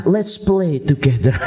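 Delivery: slow, friendly invitation ending in a laugh; lightly voiced fun dad, laughing; mild dad voice offering to play; playful dad. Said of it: "kalau papa kumpul".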